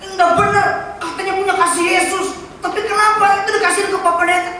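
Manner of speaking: preacher acting out a hurt church member's indignant protest; mock angry complaint. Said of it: "Mungkin dia marah-marah".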